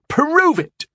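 high, furious shout; angry demand. Acted. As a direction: shout it as a challenge. Angry knight challenges narrator.

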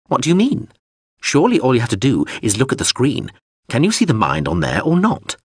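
high, insistent drone voice; pushy drone. Acted. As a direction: nag him like a fussy machine. Drone questions Hawser.

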